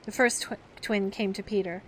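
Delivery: plain reading voice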